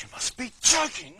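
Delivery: loud, high-pitched disbelief; incredulous outburst. Retold or imitated. Man balks at forgiving the taxi driver, incredulous outburst. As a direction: blurt it out, pitch leaping up. Retold incredulous reply to God.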